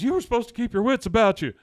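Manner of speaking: loud, high, fast exasperated shout; shouted reproach, pitched well up; retold yell. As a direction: yell it as a rapid accusation. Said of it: "and then Hank yells".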